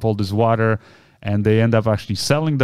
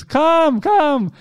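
high-pitched, urgent beckoning call; acted calling out; insistent calling; 3 dB louder than usual. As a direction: call someone over, insisting. Father keeps calling her over.

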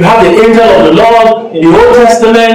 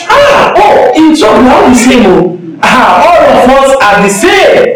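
high scoffing exclamation, then dismissive; 1 scoffing unbeliever; 3 semitones higher than usual. Retold or imitated. Preacher mimicking an unbeliever mocking churchgoers, high and scoffing. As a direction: sneer that all churchgoers are alike. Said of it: "You know what the unbeliever thinks?".

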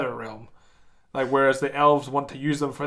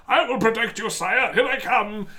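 noble elf knight; 6 semitones higher than usual. Acted. Mocking noble Lord of the Rings elf.

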